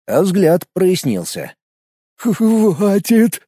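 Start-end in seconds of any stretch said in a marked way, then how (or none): 2.2-3.4 raspy, wheezing plea; hoarse wheeze. A narrator's reading. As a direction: rasp it out hoarsely, chilled. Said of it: "просипел он".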